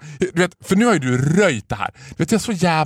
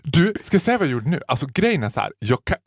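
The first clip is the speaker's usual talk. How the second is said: ordinary delivery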